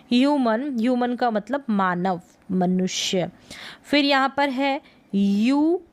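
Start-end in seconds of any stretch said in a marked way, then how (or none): none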